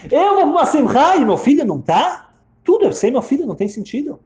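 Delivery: high, loud outraged objection; indignant protest. Retold or imitated. Bereaved mother refusing her husband's celebration, indignant. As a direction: protest with pitch flying up. Acted protest against going to party.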